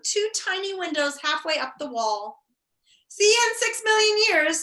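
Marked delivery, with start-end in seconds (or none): 3.1-4.6 gloating jailer. Acted.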